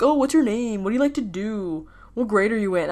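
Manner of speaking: lilting mock small talk; condescending. Freshmen pestering her at the table, mock condescending. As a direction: imitate patronising, fake-interested questions. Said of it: "a condescending, you know, like, way".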